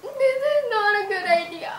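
laughing through tears; whimpering laugh; laugh-crying. Real, not acted.

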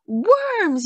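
high, loud one-word yell. A narrator's reading. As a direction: yell it out like a startled child. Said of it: "yelled Nali".

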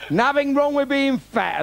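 high, loud, slowed-down declaration; loud slow setup. Acted. Comic setting up a joke to the audience, loud and slow. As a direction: bellow it slowly to the crowd.

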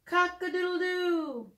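high crowing cock-a-doodle-doo; playful rooster call for the kids. Pretend. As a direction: crow it out like a rooster. Rooster crow in storybook.

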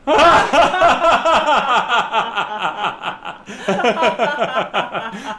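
high, long peals of laughter; exercise laugh. Shown as a demonstration. Leader stopping at red light to laugh, hearty laugh. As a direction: explode into laughter on cue. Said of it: "we stop and we laugh".

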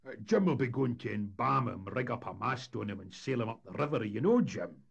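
loud Scots needling of a schemer; mock the plan with irony; ironic. A narrator's reading. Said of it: "said MacPhail with irony".

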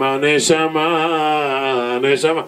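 slow, high drawn-out call; a mild calling out; heavenly calling. Acted. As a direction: call the soul from heaven, stretched out. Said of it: "בשמיים צועקים".

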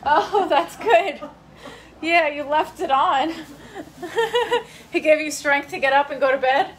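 real laughter through the words; laughing. Real, not acted. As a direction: laugh as you tease her. Laughing at mom's answer.

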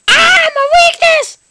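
very high, loud mock-dramatic wail; hammed-up high-pitched imitation; copied cry. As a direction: shriek it in hammed-up dismay. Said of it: "very like her".

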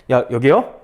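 ordinary talk, nothing marked

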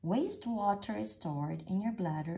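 usual speaking voice

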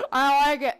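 ordinary delivery

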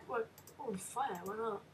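ordinary delivery

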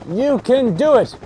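slow, high, loud "You can do it"; emphatic urging. Real, not acted. Player urging on the climb, slow emphatic cheering. Urging on, stretched second take.

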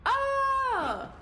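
high sudden scream of fright; startled scream. Real, not acted. Gamer jolted by a scare, screaming. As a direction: yelp as something jumps out.

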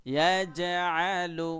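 ইয়াজআলু intoned slowly with a bounce on জ; qalqala demo. Shown as a demonstration. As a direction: recite ইয়াজআলু with a push on জ. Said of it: "ধাক্কা দিয়ে".

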